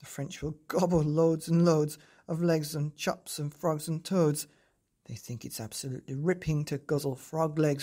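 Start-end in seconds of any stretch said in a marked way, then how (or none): none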